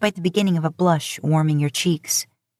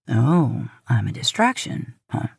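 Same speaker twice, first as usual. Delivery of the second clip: lower, playful flirting male voice; flirtatious; 5 semitones lower than usual. A narrator's reading. Man flirting with her on the phone, lower and playful. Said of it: "Dean says flirtatiously".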